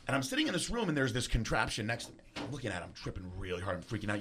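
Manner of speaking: normal talking voice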